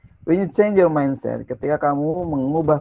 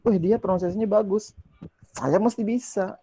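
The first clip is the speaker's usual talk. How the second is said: higher, upbeat "Wih" of motivation; excited self-encouragement; 7 semitones higher than usual. Retold or imitated. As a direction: cheer yourself on when a friend does well. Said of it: "Ya, gitu, kan". (semangat, nadanya lebih tinggi)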